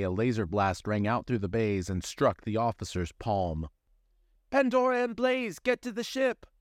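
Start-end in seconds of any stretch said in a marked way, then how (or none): 4.5-6.5 high, urgent call to the crew; voice over the intercom. Acted. Intercom voice orders Pandora and Blaze aboard, high and urgent. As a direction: shout the order over a ship speaker. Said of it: "The voice came from the ship's intercom".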